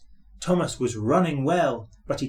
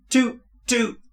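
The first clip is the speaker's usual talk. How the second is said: impatient horn toot; 10 semitones higher, 4 dB louder than usual. A narrator's reading.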